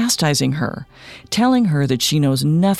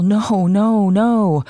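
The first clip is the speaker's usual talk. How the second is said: higher-pitched, restrained cry of protest; mild yell; 5 semitones higher, 4 dB louder, much slower than usual. A narrator's reading. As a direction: narrate a crowd's yell, lifted but contained. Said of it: "while others yell".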